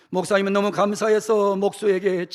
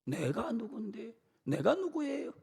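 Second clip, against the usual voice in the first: much softer, slow sneering echo; quiet mocking aside of who am I; mock arrogance, hushed; 4 semitones higher, 8 dB quieter than usual. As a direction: mutter it like a petty complaint.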